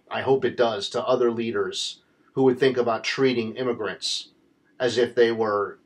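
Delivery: ordinary talk, nothing marked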